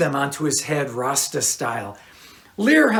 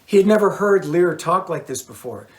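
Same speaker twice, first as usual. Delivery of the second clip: ordinary delivery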